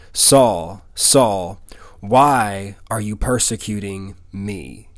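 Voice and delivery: low, slow, solemn divine voice; measured and grave, each word given room; voice from heaven. Acted. Voice from heaven calling to Saul, slow and solemn. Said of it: "heard a voice saying to him".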